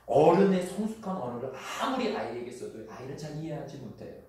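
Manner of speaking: ordinary delivery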